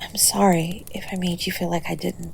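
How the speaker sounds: "I'm sorry" breathed out in a whisper. Acted. Whispered apology to partner.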